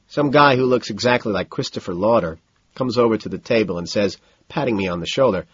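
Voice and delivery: usual speaking voice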